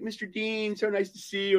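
high sweet clerk, mildly mocking; lightly voiced syrupy bank clerk.

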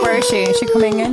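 mock surprise. Mock searching for guest.

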